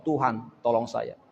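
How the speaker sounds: quiet plea. Shown as a demonstration. Believer quietly asking God for help. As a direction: pray it humbly and slowly. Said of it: "tanda kita berserah dan meminta tolong".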